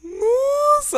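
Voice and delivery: high, loud amazed Nossa; surprised gasp. Real, not acted. Player shocked at partner's build, high loud surprise. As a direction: blurt it out, stunned by what you see.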